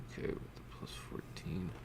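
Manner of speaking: whispered under the breath; whispering. Real, not acted. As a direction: murmur it to yourself, voiceless. GM muttering a roll bonus.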